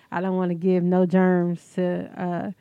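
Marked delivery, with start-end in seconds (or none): none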